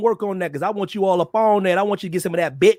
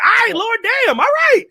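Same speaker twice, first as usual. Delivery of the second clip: high, loud resigned yell; resigned shout; 4 dB louder than usual. Retold or imitated. Answering the Lord with a high exasperated shout. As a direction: throw up your hands and shout at the Lord.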